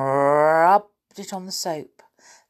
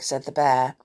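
plain reading voice

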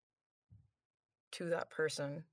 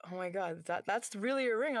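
normal talking voice

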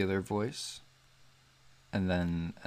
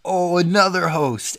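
loud, high exclamation of pretend discovery; mock surprise; 10 semitones higher, 11 dB louder than usual. Host acting out biting a Twinkie, mock surprise. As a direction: exclaim it as a delighted, hammed-up surprise.

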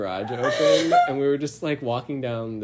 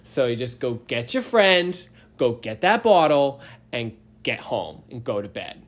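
put-on Boston cop accent; 4 semitones higher, 6 dB louder than usual. Boston cop scolding drunk teens, loud mock Boston accent. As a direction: lecture the kids like a gruff Boston cop. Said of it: "such a strong Boston accent".

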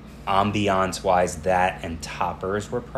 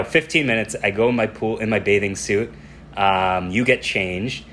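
usual speaking voice

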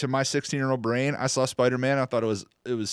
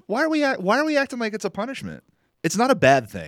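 high, fast disbelieving question; incredulous; 11 semitones higher, faster than usual. Real, not acted. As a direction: push back in disbelief. Incredulous pushback.

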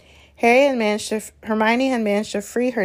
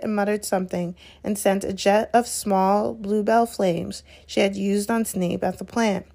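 usual speaking voice

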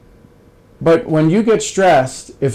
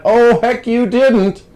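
high, louder incredulous exclaim; mock disbelief; 9 semitones higher, 4 dB louder, much slower than usual. Friend reminding him of yesterday's fight, mock disbelief. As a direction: play the friend who can't believe it.

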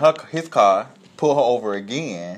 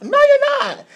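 loud, very high officer comeback; mock shouted retort; 7 dB louder, slower than usual. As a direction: fire back over her screaming. Acted officer arguing back.

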